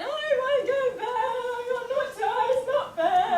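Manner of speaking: hammed-up bedtime whinge; mock whining child. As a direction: wail it out, a child putting on a tantrum. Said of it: "that voice that you just did".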